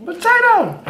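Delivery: squealing excited. Real, not acted. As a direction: shriek it with glee. Excited food exclamation, repeated.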